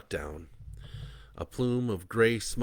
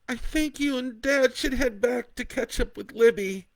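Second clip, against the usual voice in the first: between sobs; 7 dB louder than usual. Acted. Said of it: "Kat said between sobs".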